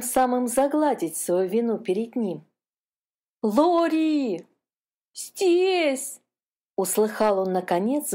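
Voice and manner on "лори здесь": put-on high, childlike cry for help from afar; little brother calling. A narrator's reading. Trapped calf calling to his brother, high distant cry. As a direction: call out thin and high so they can find you. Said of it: "голос своего братишки".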